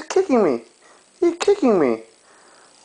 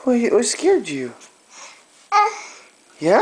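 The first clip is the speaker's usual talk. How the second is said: normal talking voice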